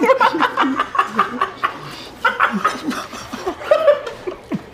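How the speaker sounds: long, high-pitched laughing fit; helpless, prolonged cackling laughter; fou rire. Real, not acted. Rit aux éclats. (long fou rire aigu)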